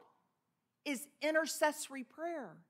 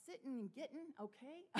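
quiet puzzled echo; 12 dB quieter than usual. Retold or imitated. Speaker echoes the twang quietly.

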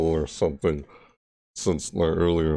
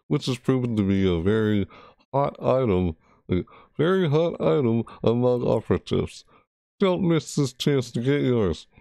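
higher, bright ad-announcer read of the promo blurb; 6 semitones higher than usual. Acted. Gamer reading the event promo to stream viewers in an upbeat ad-announcer voice. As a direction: sell it like an in-game ad.

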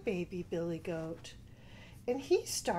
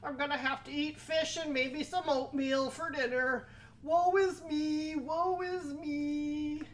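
wailing troll; 7 semitones higher than usual. A narrator's reading. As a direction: whine woefully as the defeated troll. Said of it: "And the troll said".

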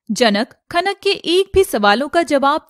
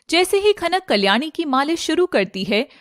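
ordinary delivery

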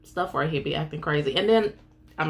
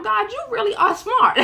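laughing; 5 dB louder than usual. Real, not acted. Marvelling at God, laughing.

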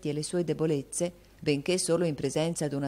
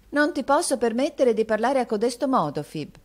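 put-on high, louder scolding young lady; haughty mistress, raised voice; reproving mistress; 9 semitones higher, 7 dB louder than usual. A narrator's reading. Mistress rebukes her maid.

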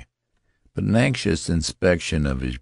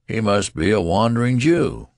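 raised, louder mocking call; jeering shout; 6 semitones higher, 5 dB louder than usual. A narrator's reading. Undergraduate in the mob taunting Benjamin, jeering. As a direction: call it after him as a taunt.

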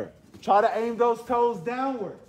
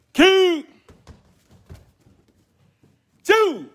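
shouted count; 5 dB louder than usual. Real, not acted. Karate teacher shouting count.

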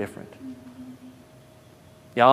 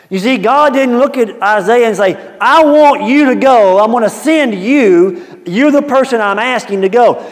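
preaching; 10 semitones higher, 11 dB louder than usual. Acted. Preacher voicing God to congregation, loud. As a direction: drive each word home. Preaching God's imagined words.

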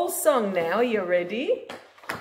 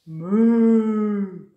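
moo; 7 dB louder than usual. Acted. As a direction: moo like a cow. Cow noise for child.

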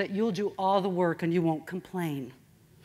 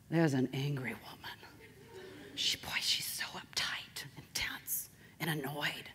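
slow, hushed mock gossip; hushed, unvoiced imitation of a wary neighbor; confiding whisper; 3 semitones lower, 8 dB quieter than usual. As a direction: whisper it as a neighbor's secret verdict. Voices neighbor's verdict on her.

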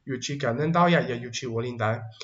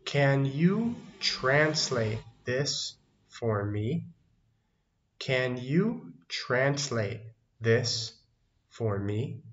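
very slow, stretched words; slow teaching model; much slower than usual. Shown as a demonstration. Teacher models an English request for learners, very slow and spaced.

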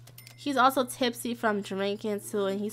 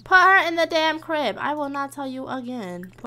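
exasperated order; 3 semitones higher than usual. Real, not acted. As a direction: raise your voice at someone ignoring you. Repeats an ignored order, exasperated.

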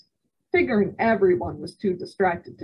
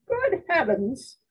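high, slow startled gasp of words; shocked cry; 9 semitones higher, slower than usual. A narrator's reading. Startled high cry. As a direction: grandmother crying out in alarm at breakfast. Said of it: "cried my grandmother".

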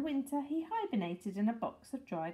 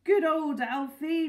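louder, higher, drawn-out praise; hearty cheer; 5 semitones higher, 7 dB louder than usual. A narrator's reading. Mister Hoppy celebrating with Mrs Silver, hearty. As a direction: give Alfie a hearty cheer. Hoppy hails Alfie after engagement.

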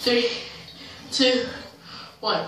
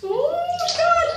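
high squeal; slower than usual. Real, not acted. Lowering into ice bath.

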